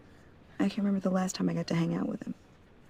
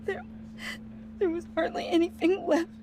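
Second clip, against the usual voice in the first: crying; 11 semitones higher than usual. Acted. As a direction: voice breaking into tears. Breaks down describing remains.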